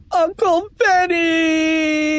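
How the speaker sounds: high, long drawn-out wail. A narrator's reading. Kipper curled up calling uncle, high wail. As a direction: wail the name, stretching the end. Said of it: "he wailed".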